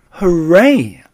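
high, loud cheer; excited cry. A narrator's reading. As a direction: cheer it out with delight. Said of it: "I cried".

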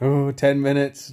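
eager, rising countdown call; excited countdown. Retold or imitated. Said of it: "you were like that".

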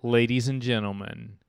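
normal talking voice

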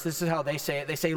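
ordinary delivery

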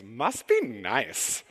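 high, slow, drawn-out envious must be nice; Valley girl catchphrase. Retold or imitated. Pastor imitating the Valley girl coworker, high and slow. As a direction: pitch it up and stretch it like a jealous Valley girl. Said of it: "And Susie goes".

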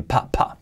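"pa, pa" with popped P sounds; "pa, pa" as short, puffed, voiceless bursts; marked P. Shown as a demonstration. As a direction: pop each P in "pa, pa". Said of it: "y la P también".

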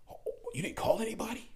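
high, thin, incredulous squeak; airy, quick disbelief; mock shock. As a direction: imitate a stunned onlooker, voice shooting up. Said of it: "it was just a shock to them".

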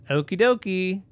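loud, chirpy robot reply; robot crying out. Acted. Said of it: "cried the robot".

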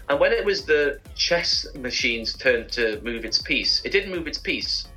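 ordinary talk, nothing marked

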